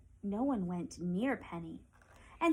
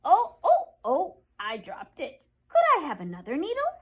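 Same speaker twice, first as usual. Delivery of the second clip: high, loud oh oh oh, then a quick plea; old woman flustered by dropping her needle; dismayed cry; 7 semitones higher, 13 dB louder than usual. Acted. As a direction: yelp as the needle falls, then hurry to ask. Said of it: "asked the woman".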